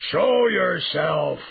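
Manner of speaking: loud, high bellowed command, drawn out; beast's roar. A narrator's reading. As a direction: roar the order at someone hiding. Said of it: "the beast roared again".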